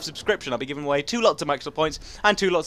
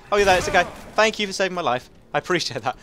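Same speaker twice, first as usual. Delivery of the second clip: ordinary talk, nothing marked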